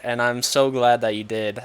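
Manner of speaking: usual speaking voice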